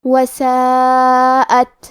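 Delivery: وساعت very slow, four-count madd, breathy final تا; long madd recitation. Shown as a demonstration. Teacher demonstrating وساعت, slow recitation. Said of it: "تو ہمیں یہ کلمہ کو ایسے پڑھنا ہے".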